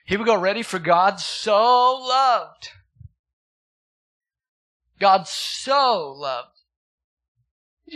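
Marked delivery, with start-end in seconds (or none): 0.6-2.7 "so" stretched very long, high and loud; high, slow proclamation leaning on "so"; stress on SO. Shown as a demonstration. Stressing the verse's word "so. 5.0-6.6 "so" drawn way out, loud; very slow, loud emphasis on "so"; stress on SO. Shown as a demonstration. Repeats verse for emphasis.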